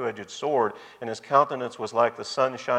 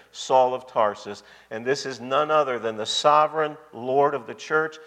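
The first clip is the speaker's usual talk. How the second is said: normal talking voice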